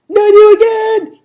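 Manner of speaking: very loud, high-pitched yell; high, full-blast cry of dismay; shouting. A narrator's reading. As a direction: shout in alarmed exasperation. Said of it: "he shouted".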